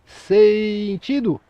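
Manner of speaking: "sentido" stretched very long, falling at the end; "sentido" arrastado, voz de comando; drawn-out final command. Shown as a demonstration. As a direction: hold "sentido" out long like a parade call. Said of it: "a última entonação da palavra".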